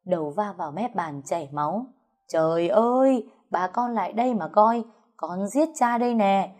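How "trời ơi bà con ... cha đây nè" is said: wailing for help. A narrator's reading. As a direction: fake a dying man's cry, building higher. Father fakes injury, calls neighbours.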